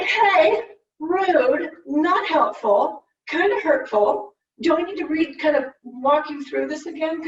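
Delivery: high, loud offended protest; indignant retort. Retold or imitated. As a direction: push back, stung and indignant. Hurt retort to mom.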